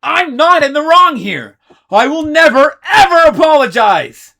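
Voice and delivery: high, loud defiant shout; furious refusal. Acted. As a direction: refuse at the top of your lungs. Ace refuses to apologize.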